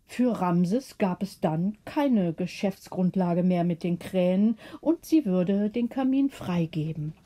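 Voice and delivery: plain reading voice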